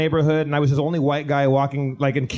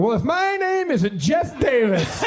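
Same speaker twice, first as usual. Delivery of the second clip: high, drawn-out mock proclamation; grand self-announcement; 10 semitones higher, slower than usual. Traveler stepping out of the car in Louisiana, mock grand announcement. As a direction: stretch the words out high, showing off the name. Announcing himself for free stuff.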